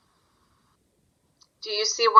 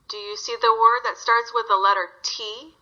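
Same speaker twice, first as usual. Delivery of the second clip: ordinary delivery, nothing marked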